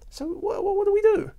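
high-pitched flustered stammer, a mock clueless reader; baffled newcomer. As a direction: play the lost first-timer, voice jumping up. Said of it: "they'll say".

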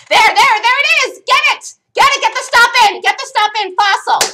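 high, loud, cheering yells; excited shouting. Real, not acted. Trader yelling at the fill to his chat, high excited shouts. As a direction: yell at the trade to fill, all out. Trade hits, calling it out live.

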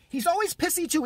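ordinary delivery

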